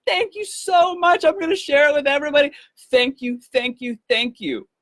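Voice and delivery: very high, effusive; gushing thanks. Retold or imitated. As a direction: pour out the thanks. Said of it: "she says to me".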